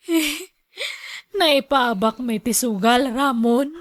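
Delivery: tearful confession. Acted. Confessing gambling loss, crying.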